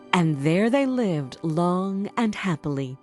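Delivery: plain reading voice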